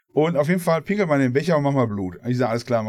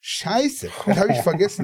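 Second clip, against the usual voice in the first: imitating a flustered old lady, higher-pitched; hammed-up old granny cursing, pitched up; old lady swearing; 6 semitones higher than usual. Teller mimics the old woman at the till, pitched up. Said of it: "sagt die".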